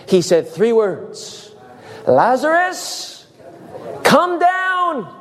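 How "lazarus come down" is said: slow, high-pitched, drawn-out summons; calling out. Acted. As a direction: call to someone far away, stretching the name. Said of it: "called into the place of the dead".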